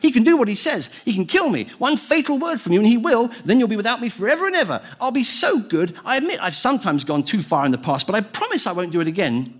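high-pitched, urgent lizard voice; chattering lizard. A narrator's reading. Lizard pleading with the ghost for its life, chattering. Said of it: "the lizard began chattering loudly".

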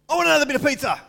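put-on high, loud, rude kid's demand; pushy greedy kid voice; demanding child. Shown as a demonstration. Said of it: "Do, do you get the difference?".

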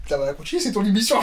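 distant off-mic voice; far from mic. Real, not acted. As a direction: speak from away from the microphone. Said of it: "des gens qui fuient le micro".

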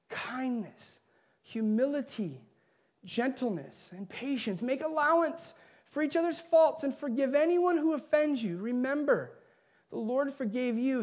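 a gentle, fading reading of the passage; scripture reading. Acted.

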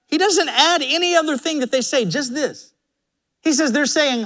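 ordinary talk

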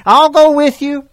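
high, loud, pleading call; eager plea. Retold or imitated. Eager follower calling after Jesus, high and pleading. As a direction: chase after him, begging not to be left. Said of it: "this man is saying".

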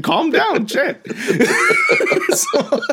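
high laughing protest. Real, not acted. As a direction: laugh while telling him to calm down. Laughing at cohost's reaction.

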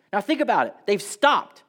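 ordinary talk

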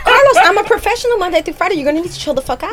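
politely impatient, clipped professional tone; like a social worker losing patience; per my last email voice. Acted. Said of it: "her per my last email voice".

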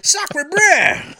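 high loud hammed-up French accent; overdone French exclamation. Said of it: "almost your catchphrase".

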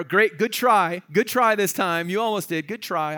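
patronizing dismissal. Acted. Prideful Agrippa putting Paul down, patronizing mock praise. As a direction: brush him off with drawn-out false encouragement. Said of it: "might have been that kind of a thing".

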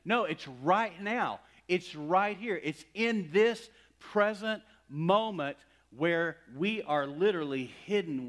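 loud, high, slow insistence; emphatic preaching. Real, not acted. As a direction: drive every word home to the congregation.

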